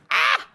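short, high-pitched mock yelp; brief acted-out squeal; mock scream. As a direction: let out a quick high "ah". Acting out the shared outburst.

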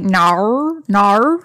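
"no" bent into Australian "nar"; Australian accent. Shown as a demonstration. Host showing off to co-host, Australian accent. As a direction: show off an Aussie "no" as "nar". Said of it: "show off my Australian accent".

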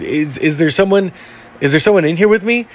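a mild imitation of someone scared alone in the dark; retold frightened plea.

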